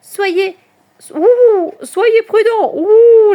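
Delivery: loud, high hooted warning; Charlotte. A narrator's reading. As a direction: warn them with owl hoots between words. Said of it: "les avertit Charlotte". (avertissement ululé, aigu et fort)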